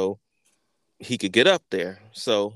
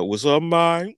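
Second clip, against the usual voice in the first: higher, louder mock reply; friend answering a call; 8 semitones higher, 5 dB louder than usual. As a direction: imitate a pal piping up when called.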